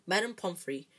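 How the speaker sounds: ordinary delivery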